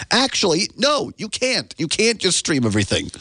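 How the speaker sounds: high-pitched mock-exasperated correction; playful, emphatic protest; indignant rebuttal. Real, not acted. Rebuts those who mock his DVDs.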